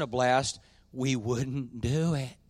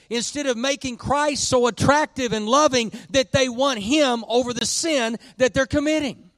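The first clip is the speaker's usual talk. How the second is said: high, driving pulpit delivery building louder; impassioned preaching; 9 semitones higher, 5 dB louder than usual. Real, not acted. Pastor telling church why nobody admits sin is fun, impassioned. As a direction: preach it at full force, stressing key words.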